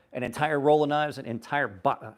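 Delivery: normal talking voice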